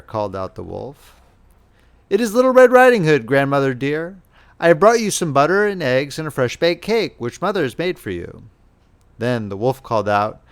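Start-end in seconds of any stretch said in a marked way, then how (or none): 2.1-8.4 put-on higher and faster little girl at the door; bright child announcing herself to grandmother; girl calling to grandmother through the door, cheerful.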